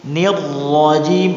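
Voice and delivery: "Nirrajim" lambat, ro dibaca tebal. Shown as a demonstration. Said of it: "Ro-nya tebal". (ro tebal, pelan)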